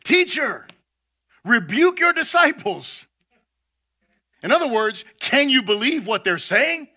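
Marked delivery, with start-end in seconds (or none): 0.0-3.0 raised, higher-pitched rebuke called out; indignant Pharisees. Acted. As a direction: scold the teacher from the crowd like a put-out Pharisee. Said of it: "called to him from the crowd".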